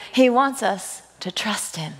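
normal talking voice

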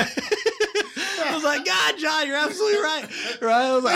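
high, laughing through the words; can barely get it out for laughing. Real, not acted. Cracking up at sponsor's joke.

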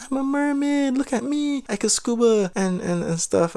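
high-pitched showing-off mimicry; mock a carefree swimmer, pitch way up then easing; mocking mermaid voice. Storyteller mocking others swimming around freely, mermaid voice.

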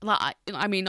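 halting speech with stuttered starts; stuttering. Real, not acted. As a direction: stutter through with long pauses. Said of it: "I'm doing it now".

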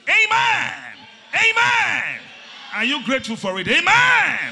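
high, full-force Amens and Hallelujah; exultant preacher's shout; shouted praise. Real, not acted. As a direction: whip the congregation up into praise. Rousing congregation to shouted praise.

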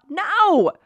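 high, emphatic "no"; exclaimed, high-pitched no; emphatic refusal. Real, not acted. Rejecting the idea of taking it.

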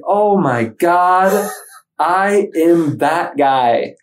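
slow, loud, high-pitched dismay; dramatic horrified realisation. Retold or imitated. Man seeing his own funeral, loud dawning horror. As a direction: draw it out in mock horror.

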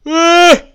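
sudden yell. Real, not acted. Sudden loud outburst on stream.

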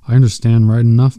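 plain reading voice

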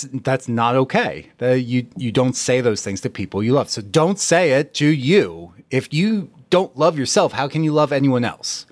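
ordinary delivery

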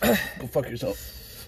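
slow, strained through the burn; forcing words past a burning tongue; burning mouth. Real, not acted.

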